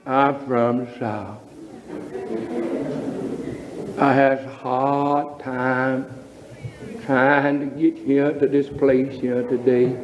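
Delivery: low, slow Southern drawl with a laugh. Shown as a demonstration. A Southern visitor grumbling about the trip, in a low drawl. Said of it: "what makes that draw".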